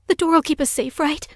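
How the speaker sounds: high, pleading fearful question; beg, scared, voice up high; begging. Acted. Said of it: "seemed to be begging more than asking".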